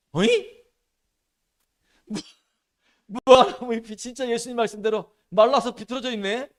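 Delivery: high, loud astonished cry; shocked surprise. Pretend. Disciples finding the fig tree withered, loud shocked surprise.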